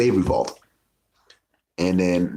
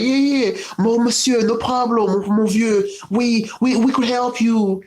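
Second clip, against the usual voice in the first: put-on terrible French accent. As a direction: ham up a bad French accent. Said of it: "Terrible French accents".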